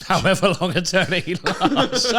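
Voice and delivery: high, slow, laughing echo; mocking repeat. Mocking the co-host's silly eternity remark, high and laughing. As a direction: repeat it back through helpless laughter.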